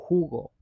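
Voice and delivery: jugo said "HOO-go"; Spanish J as H. Shown as a demonstration. Teacher giving a Spanish word example, jugo pronounced "HOO-go". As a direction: start jugo with a breathy English H. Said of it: "seeing an H".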